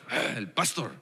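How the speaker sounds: voz mucho más grave, marcial; cuádrate y saluda grave; saludo grave imitado. Retold or imitated. Saludo imitado al pastor Tim. (saludo grave y marcial)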